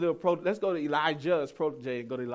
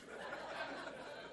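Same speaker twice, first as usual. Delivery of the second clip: breathy laugh; 14 dB quieter than usual. Real, not acted. Laughing at himself.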